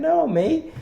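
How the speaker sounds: sudden high, disbelieving "No, mate"; shocked dismissive retort; incredulous no. Real, not acted. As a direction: scoff in disbelief, pitch jumping way up. Incredulous retort to pundit claim.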